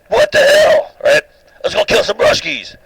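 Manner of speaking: cartoon panic over Russians; mock outraged American. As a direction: ham up an indignant American going bonkers.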